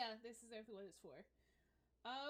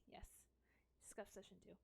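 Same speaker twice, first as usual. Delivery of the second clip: usual speaking voice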